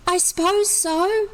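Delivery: high small voice, half persuaded; a little girl only half convinced. A narrator's reading. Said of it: "half-convinced voice".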